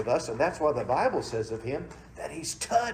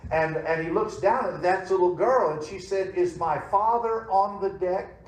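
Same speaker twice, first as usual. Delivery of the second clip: raised, high telling of the storm at sea; building preacher's storytelling; 5 semitones higher, 5 dB louder than usual. Acted. Pastor telling the congregation about the storm, raised preaching.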